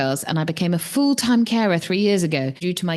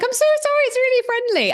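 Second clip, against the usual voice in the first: high, breezy dog-owner impression; mimicking dog owner; faster than usual. Runner imitating the dog owner's apology, high breezy mimicry. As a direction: chirp it like the unbothered owner. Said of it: "she was like".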